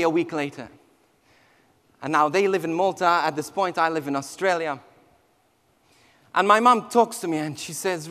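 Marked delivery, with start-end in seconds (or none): none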